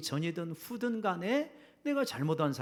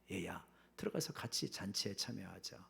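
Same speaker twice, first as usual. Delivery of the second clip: coaxing softly; 8 semitones lower, 10 dB quieter than usual. Acted. Father coaxes angry elder son.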